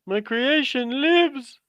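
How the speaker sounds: high, slow gloating mad scientist. Pretend. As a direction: draw it out like Frankenstein's creator.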